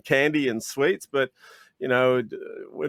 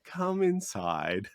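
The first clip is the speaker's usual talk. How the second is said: soft invitation; 5 semitones lower, 7 dB quieter, much slower than usual. Retold or imitated. Inviting customer into store.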